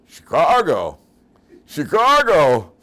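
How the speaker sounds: very loud, high, drawn-out jeer; incredulous mocking holler. Trucker mocks a lost traveler, loud incredulous holler. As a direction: bellow it in gleeful disbelief, stretching the words. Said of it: "Just like that".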